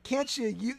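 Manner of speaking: usual speaking voice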